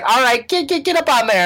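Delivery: high, bossy bus lady. Retold or imitated. Bus lady voiced in story.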